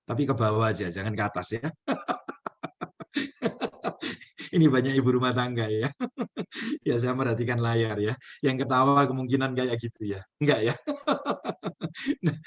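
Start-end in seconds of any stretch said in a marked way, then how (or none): 1.7-4.1 airy high laugh at his own joke; breathy laugh. Real, not acted. Speaker laughing at his own joke with the audience, airy laugh. 6.0-6.8 brief high chuckle; short laugh. Real, not acted. Speaker chuckling at the housewives in the audience, brief laugh. 10.8-12.2 airy high laugh; breathy laugh. Real, not acted. Speaker laughing at the audience's reaction, airy laugh.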